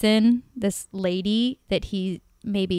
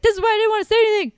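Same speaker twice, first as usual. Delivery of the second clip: mocking boyfriend; 13 semitones higher, 5 dB louder, much faster than usual. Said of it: "He's like".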